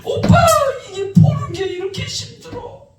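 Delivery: high, crying plea retold with a sob in it; tearful pleading. Sister sobbing and begging her brother to watch, high tearful plea. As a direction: beg your brother through imitated tears. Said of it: "막 울면서 막 애걸복걸을 하는 거야".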